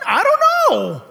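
high-pitched, emphatic show of not knowing; exaggerated uncertainty. Preacher wondering about actual mansions, exaggerated uncertainty. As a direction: pitch it way up, then let it fall.

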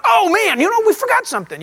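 high, quick rush of dismay; sudden realization. Acted. Traveller on the road realizing something was left behind, dismayed. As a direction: blurt it out mid-drive.

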